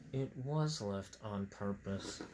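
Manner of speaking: low, soft, slow "was left on purpose"; deadpan aside. Real, not acted. Reader revealing the trap, soft deadpan. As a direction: mutter the truth under the story.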